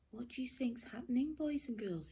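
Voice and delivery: ordinary delivery, nothing marked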